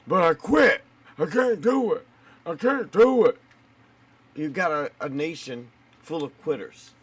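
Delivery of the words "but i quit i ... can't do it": high, whining mock complaint; whiny quitter voice. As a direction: whine like someone giving up. Mocking quitters.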